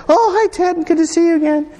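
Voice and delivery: high, prim royal greeting; a hammed-up high Queen voice; mock Queen voice. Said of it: "she's not gonna say".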